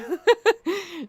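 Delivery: squeaky high laugh; short high-pitched laugh; high giggle. Real, not acted. Joke about Walmart brand.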